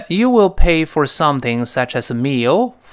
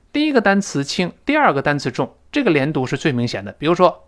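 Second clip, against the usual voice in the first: ordinary talk, nothing marked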